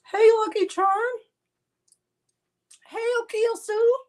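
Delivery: very high calling hello; sing-song greeting. Real, not acted.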